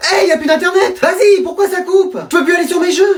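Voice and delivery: high-pitched protest; high, complaining shout; indignant outcry. Acted. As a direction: cry out in outrage, pitched high. Kids protest internet cut.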